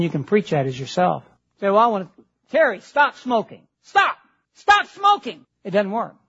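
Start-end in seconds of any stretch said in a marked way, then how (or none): 1.7-5.5 wag a finger and yell at Terry; mock scolding yell. Preacher mock-yelling at a smoker to stop.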